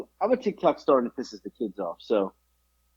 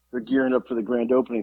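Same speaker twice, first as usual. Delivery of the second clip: usual speaking voice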